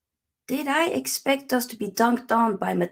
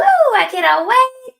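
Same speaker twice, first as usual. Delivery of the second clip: mock excitement; 14 semitones higher, 10 dB louder, slower than usual. Sarcastic cheer over cup final.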